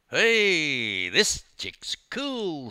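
high, drawn-out admiring youth voice; impressed street youth. A narrator's reading. One youth admiring Dawn to his mates, impressed drawl. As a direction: stretch it out, loud and impressed.

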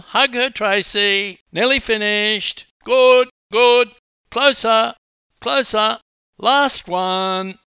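loud, high-pitched calls; photographer shouting directions. A narrator's reading. Photographer posing two swimmers, shouting directions. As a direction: fire off orders at full volume.

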